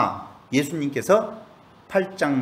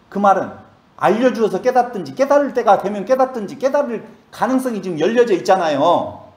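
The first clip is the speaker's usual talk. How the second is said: emphatic preaching; 5 semitones higher, 4 dB louder than usual. Real, not acted. Preacher presses the point.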